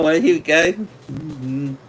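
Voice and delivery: normal talking voice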